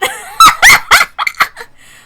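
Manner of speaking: loud, high burst of laughter. Acted. Laughing at Rudolph's flustered answer.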